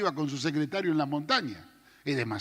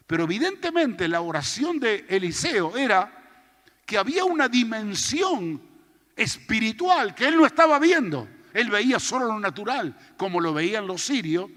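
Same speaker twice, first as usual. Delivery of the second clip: fiery preaching; 5 semitones higher, 5 dB louder than usual. Acted. Preacher rises to full force.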